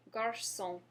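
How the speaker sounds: garçon, ç as s, nasal final on; French garçon. Shown as a demonstration. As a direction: model garçon to repeat. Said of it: "we have the word".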